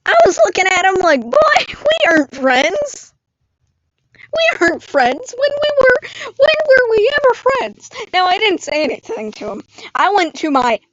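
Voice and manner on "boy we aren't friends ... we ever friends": indignant, high-pitched disbelief rising higher; incredulous retort. Acted. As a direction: throw it back in outraged disbelief. Acted indignant reply to bully.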